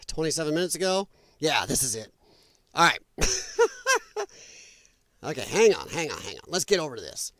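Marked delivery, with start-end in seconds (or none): 3.0-4.2 high laugh. Real, not acted. Laughing at Trump clip.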